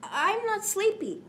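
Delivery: put-on high childish protest; little boy voice. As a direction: pout it like a small boy.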